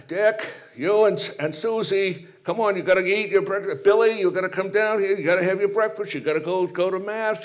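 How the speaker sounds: nagging mom. Retold or imitated. Mother calling her kids to breakfast before mass, high and nagging. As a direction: chivvy the children down to breakfast. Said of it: "You know, like that".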